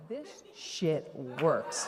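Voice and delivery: slow, low, laughing disbelief; amazed laugh. Retold or imitated. Laughing to herself at the good news, slowly. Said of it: "And I'm like".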